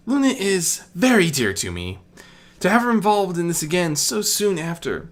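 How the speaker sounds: a fretful royal pouring out her worry; Celestia's voice. Acted. Celestia apologising to Medea for her haste, earnest and high.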